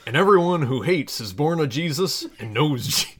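measured, solemn Bible reading; scripture reading. Acted. Reading a parody Bible passage to listeners, solemn scripture voice. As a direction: read it like a pulpit lesson.

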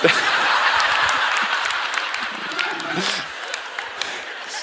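silent, airy laugh at his own trick; laughing at himself; breathy laugh. Real, not acted. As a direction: let a soundless laugh out.

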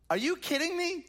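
can you believe this guy, up high; outraged disbelief. Acted. Repeats question, much higher.

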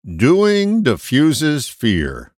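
very slow, each word weighed; measured, slow proverb read; slow quoted maxim. Retold or imitated. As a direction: intone it like an old sage. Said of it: "The Grasshopper gave us this nugget".

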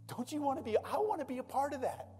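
preaching fervor. Real, not acted. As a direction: preach it with rising passion.

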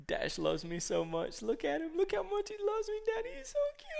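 tiny squeal climbing very high; gushing kid voice, rising to a squeaky peak; supersonic little girl. Retold or imitated. Daughter cuddling rabbit by the fire, supersonic gushing. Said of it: "her voice goes, like, supersonic".

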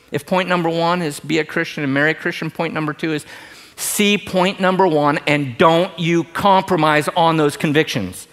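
ordinary delivery

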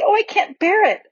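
breathless fright. Acted. Lucy pulled by unseen magic.

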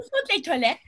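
put-on lisping French accent, sont said thont; mock lisping French tourist. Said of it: "I talk with a lisp".

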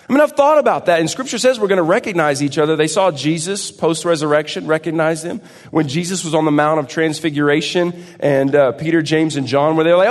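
loud, driving pulpit delivery, pushed fast; preaching at full force. Acted. Pastor fires up the congregation, loud and driving. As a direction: preach it out big to the church.

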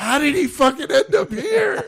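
very high, panicked imitation; freaking out. Tom spots the unwanted visitor, mock freak-out. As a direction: act out someone freaking out in a high voice. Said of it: "Tom is freaking the fuck out".

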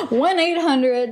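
slow announcer read; infomercial voice. Acted. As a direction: pitch it like a TV ad. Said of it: "This sounds like a fucking infomercial".

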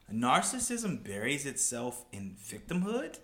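slow, questioning, lifting at each end; sceptical rereading. Real, not acted. Picking apart a tweet he calls BS, incredulous. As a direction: read it back slowly as if it makes no sense.

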